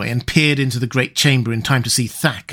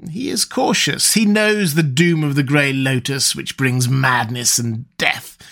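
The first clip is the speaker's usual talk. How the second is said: higher, gleeful villain's voice, savouring the carnage; gloating sorcerer Nabonidus; 4 semitones higher than usual. Acted. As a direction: gloat over the slaughter like a fiend.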